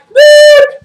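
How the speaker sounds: high, loud "beep" as a referee's whistle; shrill the whistle out with your voice; imitated whistle. Referee stopping play after a foul, imitated whistle.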